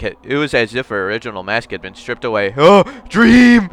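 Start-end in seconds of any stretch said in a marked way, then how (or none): 2.5-3.7 gasp of surprise. Acted. Reacts in game scene.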